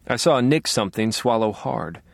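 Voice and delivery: ordinary delivery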